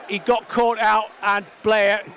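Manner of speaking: loud, high post-goal buzz; excited commentary. Real, not acted. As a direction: still buzzing after the goal.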